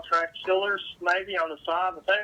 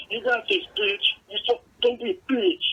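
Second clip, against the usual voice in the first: higher, louder lifter pep talk; mock gym bro hype; 6 semitones higher, 3 dB louder than usual. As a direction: hype yourself up like a meathead. Mimicking gym guys yelling.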